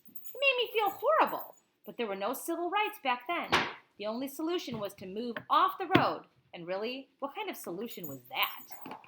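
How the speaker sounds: lively read-aloud of a picture book; reading to a child. Acted. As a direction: tell it like a bedtime story to a child. Parent reads picture book aloud.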